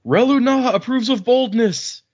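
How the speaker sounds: high, loud, proud declaration; bold defiant retort. Retold or imitated. The trader talking back to the guard, a high, loud, proud retort. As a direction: puff up and proclaim it boldly. She boldly defends herself.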